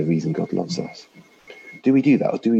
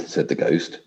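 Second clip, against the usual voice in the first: ordinary talk, nothing marked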